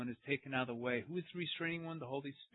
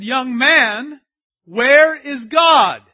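slow, much louder and higher shout; a priest shouting his question at the boy; booming priest; 8 semitones higher, 17 dB louder, much slower than usual. Retold or imitated. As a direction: roar the question slowly. Second take, shouted.

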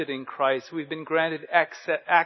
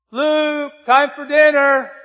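higher, louder call across the house; 11 semitones higher, 11 dB louder, slower than usual. Retold or imitated. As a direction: mom calling the kid in to dinner. Mother calls boy to dinner.

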